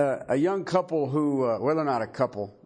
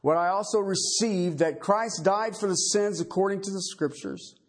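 measured, solemn Bible reading; scripture reading; 6 semitones higher than usual. Acted. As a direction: read the passage with weight and care. Reads scripture aloud.

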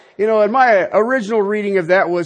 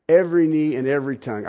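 "Every knee and every tongue" tossed off lower and fast; quick aside; 4 semitones lower, faster than usual. Shown as a demonstration. Preacher repeats "Every knee and every tongue" lower and fast, as an aside. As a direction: murmur it quickly to yourself.

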